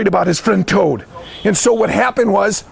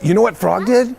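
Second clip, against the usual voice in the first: ordinary delivery, nothing marked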